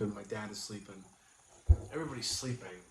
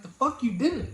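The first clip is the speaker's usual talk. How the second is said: high, loud mock retort; inner mock retort; 14 semitones higher, 9 dB louder than usual. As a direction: fire back at her.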